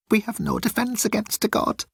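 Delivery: high, timid alien voice; high-pitched nervous alien; Moan's voice. Acted. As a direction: play Moan small and pleading.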